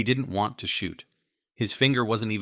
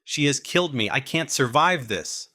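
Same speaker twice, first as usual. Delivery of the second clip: high, loud, panicked despair; a man sure he is dying; desperate fear; 6 semitones higher, 4 dB louder than usual. A narrator's reading. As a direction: gunman crying out that he is doomed. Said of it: "He was desperately afraid of something".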